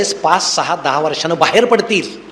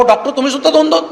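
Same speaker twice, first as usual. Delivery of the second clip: comic shout; 8 semitones higher than usual. Acted. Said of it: "हा ओरडतो".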